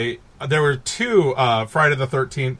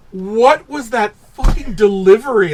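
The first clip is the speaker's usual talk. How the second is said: loud, higher-pitched retold disbelief; incredulous; 7 semitones higher, 5 dB louder than usual. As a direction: recount your baffled outburst at full voice. Retelling own baffled reaction.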